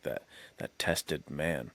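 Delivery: ordinary talk, nothing marked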